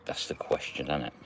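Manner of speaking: hushed knowing aside; whispered aside. Real, not acted. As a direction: lean in and whisper it to the viewers. Aside about whether she behaves.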